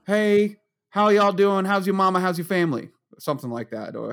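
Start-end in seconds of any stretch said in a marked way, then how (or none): 0.1-2.9 put-on Southern accent. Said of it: "Super Southern".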